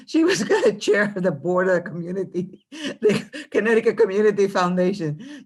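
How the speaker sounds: chuckling as she talks; laughing. Real, not acted. Laughing while praising colleague.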